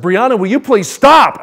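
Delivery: loud, high exasperated outburst; retold fed-up father's yell; exasperated parent. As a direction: burst out at a jabbering child. Snaps at jabbering daughter, retold.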